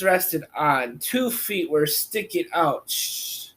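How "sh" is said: ordinary delivery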